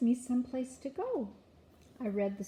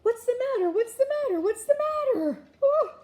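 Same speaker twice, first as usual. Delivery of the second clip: high, loud, startled repeated shouts; frantic high-pitched yelling; yelled in alarm; 9 dB louder, faster than usual. A narrator's reading. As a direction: shout like you were jolted out of sleep. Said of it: "His father jumped out of bed and yelled".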